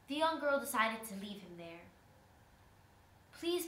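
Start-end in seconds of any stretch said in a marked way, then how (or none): 3.3-3.7 high-pitched begging word; pleading. A narrator's reading. Trapped spider begs the girl once more, pleading. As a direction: beg in a higher voice. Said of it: "pleaded the spider".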